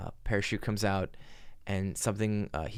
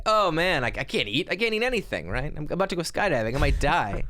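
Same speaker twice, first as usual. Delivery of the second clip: high, louder jittery panic; mock nervous jumper voice; nervous skydiver; 6 semitones higher, 5 dB louder than usual. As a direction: fret about skydiving like a scared first-timer. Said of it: "he's like".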